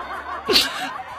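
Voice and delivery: high, breathy burst of real laughter. Real, not acted. 笑话自己被打. (忍不住大笑)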